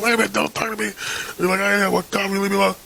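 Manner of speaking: high-pitched mock indignant protest; tripping, put out. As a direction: act flustered and fend off the talker. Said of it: "I'm tripping at the fact that someone's talking to me".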